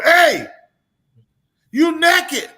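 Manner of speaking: high, loud shouts with pauses between; mock losing it. Man losing it at a naked man, mock enraged yelling. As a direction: imitate an enraged man ordering someone out. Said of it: "He just lost it".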